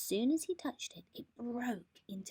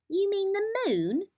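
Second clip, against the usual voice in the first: high, louder rabbit voice; a puzzled little rabbit, pitched up; 13 semitones higher, 7 dB louder than usual. A narrator's reading. Rabbit asks Mole about the moon, high and puzzled.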